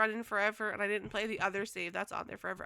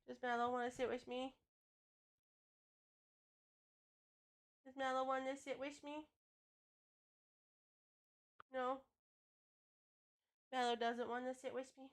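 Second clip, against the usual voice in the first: much softer cooing questions; soft pet talk; 9 dB quieter than usual. Real, not acted. Streamer quietly cooing at her cat Mallow.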